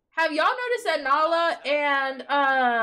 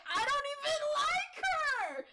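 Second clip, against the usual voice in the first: mock high voice; 10 semitones higher, 6 dB quieter than usual. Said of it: "He's like".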